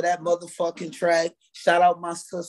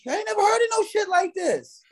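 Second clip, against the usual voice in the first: much higher voice of mock disbelief; incredulous squeal. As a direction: play it wide-eyed and squeaky. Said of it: "I'm like".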